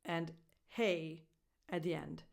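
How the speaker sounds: usual speaking voice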